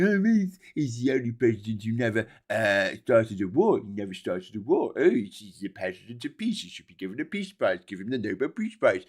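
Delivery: lightly voiced dim Trump fan; mocking supporter voice. Trump fans praising their president, mocking imitation. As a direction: imitate a foolish devotee with scorn.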